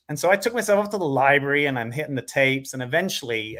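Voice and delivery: normal talking voice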